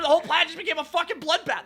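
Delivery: ordinary delivery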